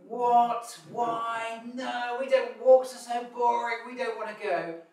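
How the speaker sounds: put-on high, loud protesting kid voice; whining children. Children refusing the family walk, loud and whiny. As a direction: complain like a child dragged out. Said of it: "And the children go".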